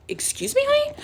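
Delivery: very high, rising offended question; mock indignant disbelief. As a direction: squeak it up in exaggerated disbelief. Acting out her offended comeback.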